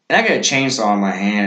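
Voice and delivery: ordinary delivery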